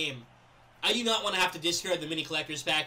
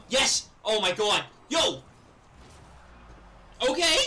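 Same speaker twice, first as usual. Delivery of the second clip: excited cheering; 3 semitones higher, 4 dB louder, slower than usual. Real, not acted. Celebrating a goal.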